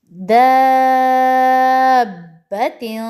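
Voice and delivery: slowly drawn दाबतिन; six-count madd. Shown as a demonstration. Teacher demonstrating the mandatory madd to students, held long. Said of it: "six counts का क्या मतलब है".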